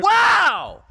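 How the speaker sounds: loud high mock amazement; a shouted fake wow.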